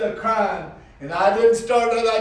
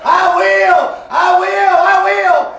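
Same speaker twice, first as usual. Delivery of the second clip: loud, high, ecstatic cries; joyful shouting; 11 semitones higher, 11 dB louder, slower than usual. Retold or imitated. Newly saved niece jumping and shouting her joy, loud and high.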